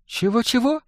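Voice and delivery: high, soft, amused question; amused disbelief. A narrator's reading. Girl mocking goblin's boast, high amused question. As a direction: ask as if about to laugh. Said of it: "рассмеялась девушка".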